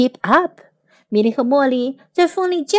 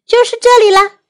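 high, loud storybook shout; 10 semitones higher, 6 dB louder, faster than usual. A narrator's reading. Tom calls to his friends above the wind, shouting. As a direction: call it out loudly over the wind. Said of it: "汤姆大声喊着".

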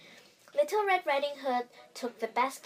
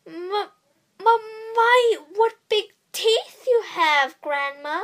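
high, loud, slow stammering fright; frightened stutter; 10 semitones higher, 9 dB louder, slower than usual. A narrator's reading. Girl at the bed stammers in fear at the wolf. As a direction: stammer it out, scared of the teeth.

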